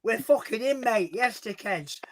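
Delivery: high-pitched Hull lad; Hull accent character. Acted. Character announcing his arrival to mates, high Hull accent. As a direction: burst in with a put-on Hull accent. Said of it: "I can't do that accent".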